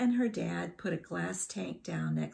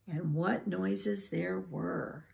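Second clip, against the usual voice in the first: ordinary delivery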